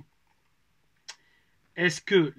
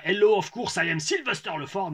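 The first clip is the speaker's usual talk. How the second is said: hammed-up French accent for a wrestling promo; forced French accent; 4 semitones higher than usual. Shown as a demonstration. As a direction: overdo the French accent in English. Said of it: "je faisais pas comme ça".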